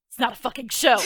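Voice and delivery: loud, curt rebuke; irritated snap. Acted. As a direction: tell off the gawkers. Snaps at staring onlookers.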